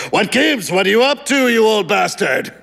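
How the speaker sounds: high, boisterous caller at ordinary volume; loud, hoarse man. A narrator's reading. Man greeting his supposed friend Ralph, loud hoarse manner. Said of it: "voice was loud and hoarse".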